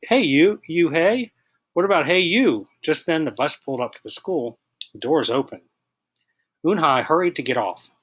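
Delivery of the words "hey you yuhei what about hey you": playful, higher "Hey, you, Yuhei" wordplay; a boy joking on the bus; joking kid. A narrator's reading. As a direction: tease her name as a silly pun. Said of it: "the boy said, like".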